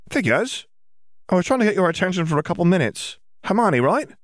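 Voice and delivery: put-on high-pitched, friendly girl's voice; high schoolgirl introducing herself; chatty schoolgirl Ginny. A narrator's reading.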